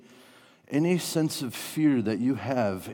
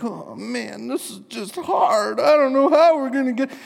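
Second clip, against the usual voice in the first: hammed-up high whine, swelling louder; exaggerated tearful complaining, pitched way up; whimpering, whining Jesus; 14 semitones higher, 8 dB louder than usual. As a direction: wail it like a mock crybaby. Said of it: "kind of a whimpering, whining Jesus".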